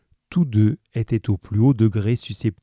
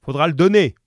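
higher-pitched call from the back room; man calling from within; 7 semitones higher, 5 dB louder, faster than usual. A narrator's reading. Husband shouts terms from the back, a contained call. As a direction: call out the demand, no louder. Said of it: "reprit la voix d'homme".

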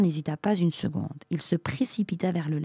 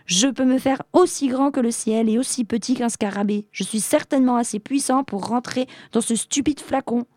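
high-pitched, indignant boasting; insistent; 6 semitones higher than usual. A narrator's reading. Genie out to prove he fits the bottle, insistent. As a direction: pitch it up and press the point. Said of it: "insista le génie".

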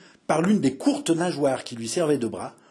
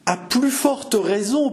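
exasperated; 9 semitones higher, slower than usual. A narrator's reading. Innkeeper exasperated at the freeloading chevalier, high and slow. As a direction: drag it out, fed up. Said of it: "poursuivit Grégoire exaspéré".

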